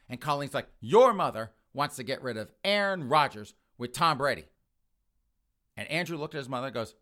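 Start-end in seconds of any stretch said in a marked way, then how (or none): 0.8-4.5 loud mock-outraged accusation; raging angry. Girlfriend telling on his mother, raging. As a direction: denounce someone loudly, stressing each name. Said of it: "she is just raging angry".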